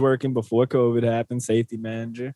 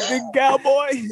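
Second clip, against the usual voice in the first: teasing cowboy drawl; 10 semitones higher, slower than usual. Acted.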